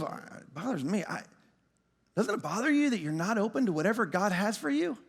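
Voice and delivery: soft earnest concern. Retold or imitated. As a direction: ask it gently but pointedly. Wife's earnest question.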